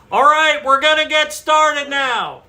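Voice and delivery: big high-pitched announcing shout; outside voice. Real, not acted. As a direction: holler it across a noisy room, drawn out. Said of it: "That's [name] using his outside voice".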